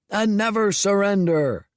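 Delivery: high rallying shout. A narrator's reading. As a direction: chant it at full voice. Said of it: "shouted the dragons at the rear".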